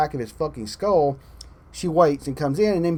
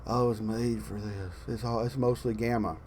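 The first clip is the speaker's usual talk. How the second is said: much softer, mumbled, deflated parody of the hero line; mocking Banner line; 5 semitones lower, 9 dB quieter than usual. A fan mocking Banner's Endgame line: soft, sarcastic parody. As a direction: sneer it under your breath as if the line is dumb. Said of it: "Banner does this stupid".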